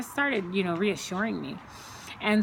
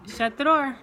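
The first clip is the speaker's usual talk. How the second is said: louder, higher order across the room; sharp call-out; 5 semitones higher, 4 dB louder than usual. Real, not acted. Mother calling across the room to shut the door, loud and sharp.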